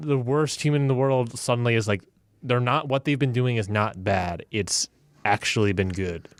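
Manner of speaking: usual speaking voice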